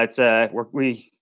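ordinary talk

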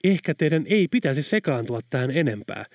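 plain reading voice

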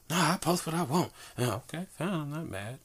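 high-pitched quoted comeback; lightly mimicked back-and-forth in a high voice; imitated retort. Voicing her retort and his answer.

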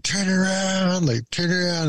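high, slow calling from the beach; family yelling at him to turn. Retold or imitated. Family on the beach calls out to the boy, high and slow.

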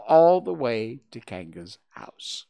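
usual speaking voice

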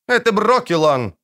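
high, proud declaration. A narrator's reading. Dryad defends her shot to the witcher, proud. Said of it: "гордо проговорила она".

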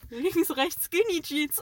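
put-on high, loud chant like passing kids; imitating boys. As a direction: shout it like a cheeky boy on a bike. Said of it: "damit haben wir mal die zwei Jungs gequotet".

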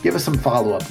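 usual speaking voice

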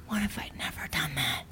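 hushed secret whisper. Retold or imitated. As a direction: breathe it like a guilty secret. Mom voices a secret fantasy.

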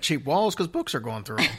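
ordinary talk, nothing marked

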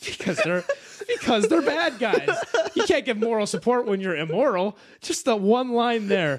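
can barely get it out for laughing. Real, not acted. Cracking up explaining the immoral support joke, real laughter.

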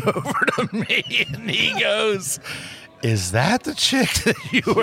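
barely holding in laughter; laughing while telling. Real, not acted. Storyteller quoting his dad in court, laughing while telling. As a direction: tell it through real giggles.

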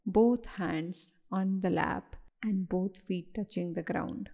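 guided meditation. Acted. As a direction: guide them calmly, one unhurried phrase at a time.

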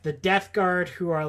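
ordinary talk

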